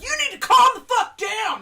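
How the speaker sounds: loud high mock yell at a panicking cook; a big retold shout; chef yelling. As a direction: bark it like a furious chef. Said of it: "the chef was like".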